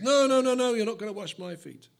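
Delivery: loud, high protest as Peter. Retold or imitated. Peter refusing to let Jesus wash his feet, loud protest. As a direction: cry out in refusal.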